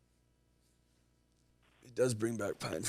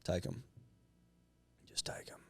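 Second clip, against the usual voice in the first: fading to a whisper; 2 semitones lower, 16 dB quieter than usual. Real, not acted. Fading out, taking the licks.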